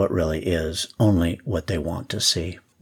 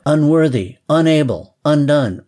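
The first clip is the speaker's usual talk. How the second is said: higher, louder ringing cry; calling out; 6 semitones higher, 5 dB louder than usual. Acted. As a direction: cry each word out across the street. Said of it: "calling out".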